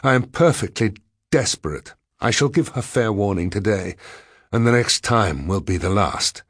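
usual speaking voice

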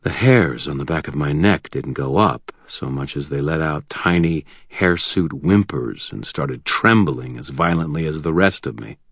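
usual speaking voice

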